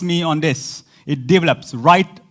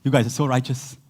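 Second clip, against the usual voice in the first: mock flattery; 7 semitones lower, 5 dB quieter than usual. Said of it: "he's looking at you and says".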